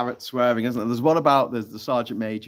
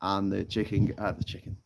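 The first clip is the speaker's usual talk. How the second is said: ordinary delivery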